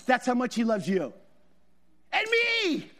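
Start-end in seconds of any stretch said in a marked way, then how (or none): 2.1-2.9 high, excited exclamation; amazed delight. Real, not acted. Preacher marvelling to congregation that he is loved, excited cry. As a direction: burst out high, marvelling at being included.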